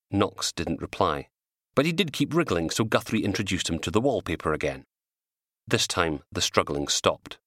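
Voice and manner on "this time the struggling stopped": ordinary delivery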